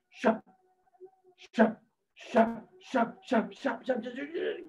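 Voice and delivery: repeated short snaps, speeding into a flurry; tongue-snapping noises. Shown as a demonstration. Storyteller showing frog gobbling mosquitoes, rapid snap noises. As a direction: imitate a frog's tongue catching bug after bug. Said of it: "los atrapaba así".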